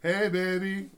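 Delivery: drawn-out singsong yell from another room; yelling from the bathtub. Acted. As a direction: Brad calling out from the tub. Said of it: "you hear Brad yell".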